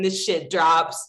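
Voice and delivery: ordinary delivery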